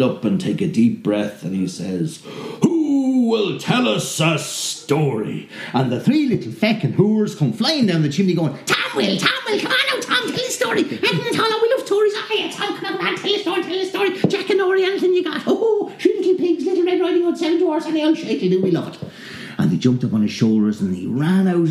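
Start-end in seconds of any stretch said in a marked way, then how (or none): 2.6-5.5 high, very slow, drawn-out ask; slow questioning. Acted. Tall man asks. 8.7-19.1 very high, shouting imp chorus; shrieking demons. Acted. Demons flying down the chimney, very high shrieking.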